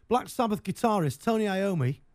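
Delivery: ordinary talk, nothing marked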